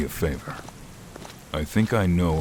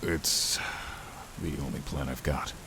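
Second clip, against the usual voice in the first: softer, almost under the breath; quiet admission; 8 dB quieter than usual. Acted. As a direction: admit it softly, a little reluctant. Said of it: "Shakar quietly admitted".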